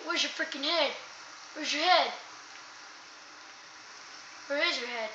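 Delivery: normal talking voice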